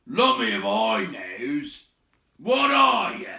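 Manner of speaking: high, loud troll demand; baffled troll. Acted. William demanding what Bilbo is, baffled troll. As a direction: bark the question at the hobbit.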